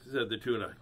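ordinary delivery, nothing marked

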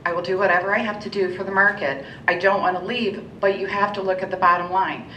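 normal talking voice

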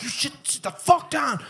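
mock menacing. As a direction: scare the kid off as a hammed-up rocker. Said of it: "I'm like".

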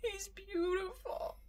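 soft, slow, choked with tears; tearful whisper. Real, not acted. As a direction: barely get it out through tears. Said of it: "do I have mascara running down my face".

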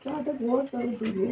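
soft, sleepy murmur; too ill to speak up; weak sick voice. Real, not acted. Wife asking husband to make tea first, soft sleepy murmur.

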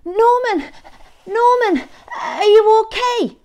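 high, loud anxious calls; worried calling. Acted. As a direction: call out to a fallen friend. Snails worried after Norman's fall.

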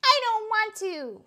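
put-on high, loud childish whine; whining. A narrator's reading. Froggy whines at his mother's swimming lesson, high whine. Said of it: "Froggy whined".

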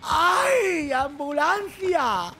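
drawn-out, high plea for an ambulance; playful, slow-stretched distress call; mock emergency cry. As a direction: wail for help in pretend panic, dragging out the vowels.

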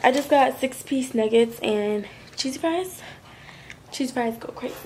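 ordinary talk

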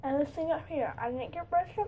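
speaking with finger in mouth; finger pulling lip down. Real, not acted. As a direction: lip held open by finger. Seen 0: Finger hooks lower lip down, showing teeth, until 1.8 s.

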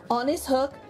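plain reading voice

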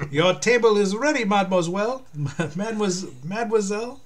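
high, loud French waiter; French accent. Acted. Waiter calling lady to table, French accent. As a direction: announce it grandly in a French accent. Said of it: "all this fucking French and German".

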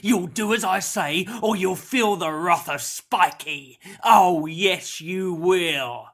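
loud villain's threat; loud, menacing growl. A narrator's reading. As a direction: bark the threat, booming on the gloat. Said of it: "growled the man".

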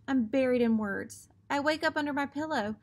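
ordinary delivery, nothing marked

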